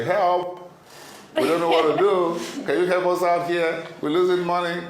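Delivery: high, loud panicked cry for help; panicked plea. Pretend. Host acts panicked call for help.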